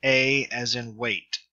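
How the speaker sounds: slow, deliberate A as in weight; careful pronunciation demo. Shown as a demonstration. Tutor modelling epsilon iota for beginners, slow and deliberate. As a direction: lay out the A sound slowly for students.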